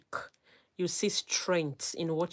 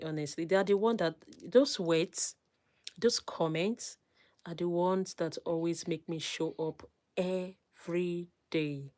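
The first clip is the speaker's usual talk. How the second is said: ordinary talk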